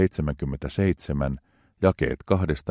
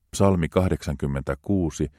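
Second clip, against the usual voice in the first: ordinary talk, nothing marked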